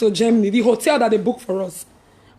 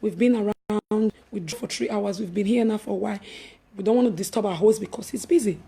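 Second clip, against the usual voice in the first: quiet; 5 semitones lower, 6 dB quieter than usual. Retold or imitated. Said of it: "I said".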